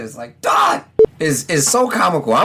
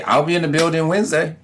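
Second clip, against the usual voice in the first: normal talking voice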